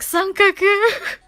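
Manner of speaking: high, loud, drawn-out squeal; excited fan shout. Retold or imitated. Acts out her own fan shout.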